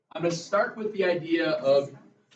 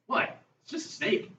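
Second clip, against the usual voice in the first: high, casual "what's the fuss" squeak; fearless son. Retold or imitated. Teacher retells son Moose shrugging at snake, mock high voice. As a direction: toss it off like it's nothing, pitched way up. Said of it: "just picks it up and goes".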